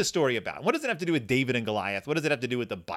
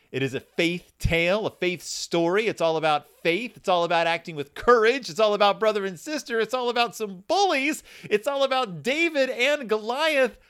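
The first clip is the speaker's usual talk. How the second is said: building announcer excitement, climbing higher with each phrase; hyped show intro; 8 semitones higher, 4 dB louder than usual. Acted. Host billing the book's themes to listeners, hyped announcer build. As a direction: pitch rising step by step to a big billing.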